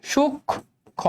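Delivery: সূক্ষ্ম stretched slowly, soft, the ক্ষ held long; careful slow demo of সূক্ষ্ম; drawn out. Shown as a demonstration. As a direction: slowly model সূক্ষ্ম as শুক্খো. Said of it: "প্রথম কথা হচ্ছে সূক্ষ্ম".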